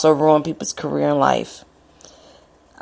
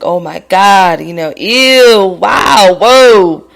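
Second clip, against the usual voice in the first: rising louder and higher to a big whoa; shocked amazement; 3 semitones higher, 8 dB louder, slower than usual. Retold or imitated. Hearing the body was on the news, stunned whoa. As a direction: pile up the shock word by word.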